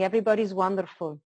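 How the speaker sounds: ordinary talk, nothing marked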